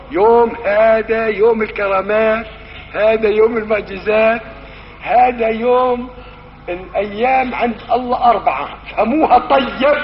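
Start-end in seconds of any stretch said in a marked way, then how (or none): 0.1-6.1 slow, drawn-out sermon chant; preaching intonation. Acted. Preacher to congregation, slow solemn intoning. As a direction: intone each day like a proclamation.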